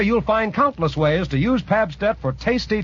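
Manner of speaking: ordinary talk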